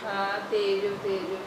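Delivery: soft, low mutter under her breath; muttered aside. Acted. Mother-in-law mutters quietly at her daughter-in-law. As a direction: grumble it quietly to herself.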